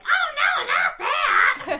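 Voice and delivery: very high, loud squealed gasp; mock horrified squeal. Schwartz's mom hears it over the phone, mock horrified squeal. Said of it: "she whispers it into the phone".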